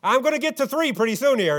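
high, loud parent counting to three; hammed-up parent yelling a countdown; mock yelling countdown. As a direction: yell the count like an exasperated parent. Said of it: "what are they doing? Just yelling".

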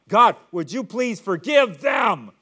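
loud, slow pleading cry; a loud, drawn-out plea to heaven. Retold or imitated. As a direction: call out to God with full force. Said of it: "he cries out".

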